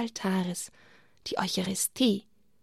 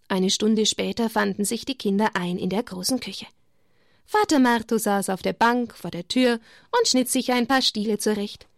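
ordinary delivery, nothing marked

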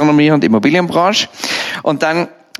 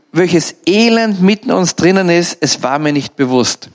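normal talking voice

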